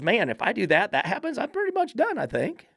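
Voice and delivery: high, put-on voice for the dog thinking it over; dog's voice. Trainer voicing his dog after a correction, high and sheepish. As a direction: play the corrected dog, suddenly squeaky and resigned. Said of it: "and the dog goes".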